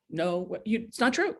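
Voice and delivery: ordinary delivery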